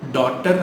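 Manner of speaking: டாட்டர் with an aa and hard t; mispronounced daughter. Shown as a demonstration. Teacher quoting how learners say it, mispronounced. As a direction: say டாட்டர் as DAA-tar. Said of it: "Which is wrong".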